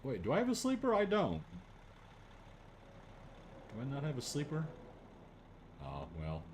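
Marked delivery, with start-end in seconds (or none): none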